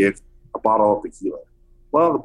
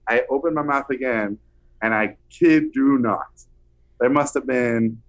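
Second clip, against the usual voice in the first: ordinary delivery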